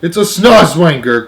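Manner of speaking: loud, high, drawn-out cry; alarmed cry. A narrator's reading. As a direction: call out the monster's name in alarm. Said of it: "cried the chief of police".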